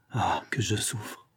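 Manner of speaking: slow, hushed groan of suffering; plaintive. A narrator's reading. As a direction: sigh it out softly, as a sufferer. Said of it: "d'une voix plaintive". (plaintif, dans un souffle)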